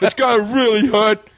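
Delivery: hammed-up drunk voice. Drunk man watching his hand swell, slurred. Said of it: "I was so drunk that it was like".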